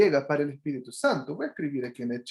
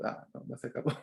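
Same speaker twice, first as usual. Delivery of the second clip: ordinary delivery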